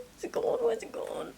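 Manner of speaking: scared breathless whisper; frightened whisper. Real, not acted. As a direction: whisper it, terrified it will hear you. Hiding from a spider.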